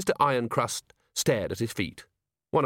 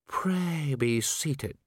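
very slow, raised, icy invitation; chilly, precise voice; 4 semitones higher, much slower than usual. A narrator's reading. Ancient herald seats the commander, coldly courteous. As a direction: stretch out the formal courtesy. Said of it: "said Dragon King of Arms".

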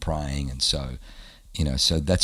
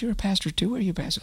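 high, hushed excited questioning; much faster than usual. Retold or imitated. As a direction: ask your neighbour in a high, secretive squeak. Excited whispered chat in line.

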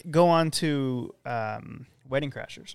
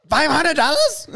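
higher and louder, questioning; high squeaky voice; 11 semitones higher, 5 dB louder than usual. Retold or imitated. Quoting movie line, high.

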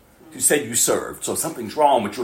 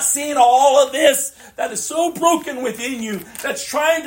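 high, loud fiery preaching; preaching at full force; 13 semitones higher, 3 dB louder than usual. Acted. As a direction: shout it from the pulpit. Preaching climax.

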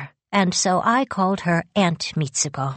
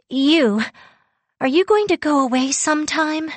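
anxious question; 10 semitones higher than usual. A narrator's reading. I felt like crying.

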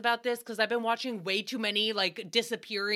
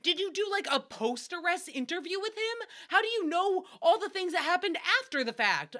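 incredulous; 7 semitones higher than usual. Retold or imitated. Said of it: "I was like".

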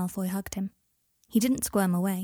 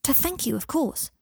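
put-on higher-pitched childish matter-of-factness; stating the obvious; 6 semitones higher than usual. A narrator's reading. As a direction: answer as if he is being thick. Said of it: "as if Harry were being deliberately thick".

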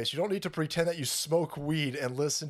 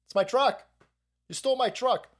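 retold confrontation; 7 semitones higher than usual.

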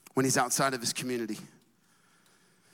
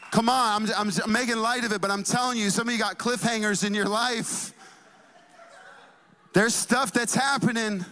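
high, driving preacher's delivery; preaching; 7 semitones higher than usual. Real, not acted. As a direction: press it on the congregation, pitched up. Preaches to congregation.

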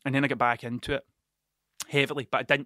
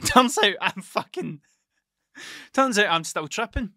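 laughing admission; 5 semitones higher, 4 dB louder, slower than usual. Real, not acted. Laughing admission of still tripping.

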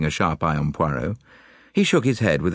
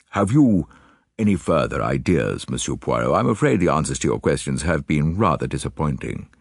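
Lord Alloway. Acted. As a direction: play the statesman, pitched down. Lord Alloway's dialogue in narrated story.

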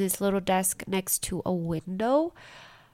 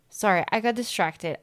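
ordinary delivery

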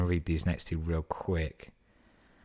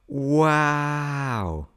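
long, high, loud wow; big drawn-out exclamation; amazed wow; 10 semitones higher, 7 dB louder than usual. Real, not acted. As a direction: stretch it out in delighted disbelief. Amazed reaction after laughing at story.

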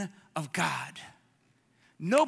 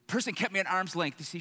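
ordinary talk, nothing marked

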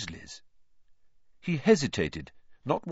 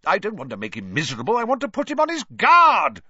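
fast, loud sharp retort; 12 semitones higher, 9 dB louder, faster than usual. A narrator's reading. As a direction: fire it straight back. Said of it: "retorted Mister Weasley".

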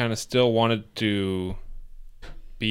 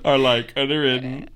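mocking old-timey voice; 4 semitones higher, 3 dB louder than usual. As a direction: imitate her reading, in a put-on voice.

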